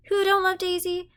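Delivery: high-pitched indignant challenge, only mildly bellowed; mock-indignant outcry held high. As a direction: act affronted, pitch jumping right up. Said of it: "Jim bellowed indignantly".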